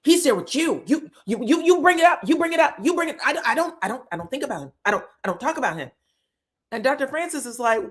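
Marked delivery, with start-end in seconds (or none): 0.0-5.9 rapid, higher-pitched protest with stuttered repeats; heated quarrel. Retold or imitated. Recapper replaying a couple's fight, heated and defensive. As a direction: argue back in a mock-heated rush.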